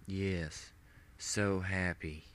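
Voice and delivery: soft, slow, lifeless monotone; dull intoning. Acted. Brainwashed partner answering the villain, dull and soft. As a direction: speak like an empty puppet. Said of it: "dully intoned".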